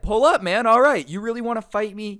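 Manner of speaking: defiant challenge. Retold or imitated. Robbed friend daring the scammer to show up, loud defiant shout. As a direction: yell it back like calling his bluff. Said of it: "he said".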